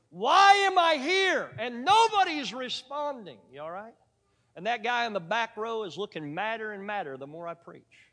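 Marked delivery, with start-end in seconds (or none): 0.1-3.4 much higher and louder, slow, pleading; desperate inner cry. Retold or imitated. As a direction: cry it out like a preacher losing heart. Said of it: "not a good thought to have".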